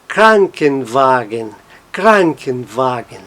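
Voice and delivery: slow Krankenwagen, KRAN strongest, WA lighter; stress on Kranken. Shown as a demonstration. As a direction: say Krankenwagen slowly, the first part a bit stronger. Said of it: "a primeira fica um pouquinho mais forte". (Krankenwagen devagar, com Kranken mais forte que Wagen)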